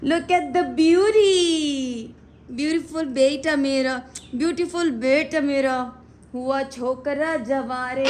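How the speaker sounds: loud, slow, thrilled praise to the bird; gushing delight. Real, not acted. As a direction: rave over its feathers. Gushing over parrot's colors.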